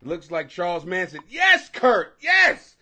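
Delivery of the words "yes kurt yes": loud reply. Real, not acted. Loudly answering chat viewer.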